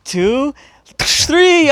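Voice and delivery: hyped countdown; building count. Retold or imitated. Counting before throwing chair.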